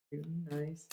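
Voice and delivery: normal talking voice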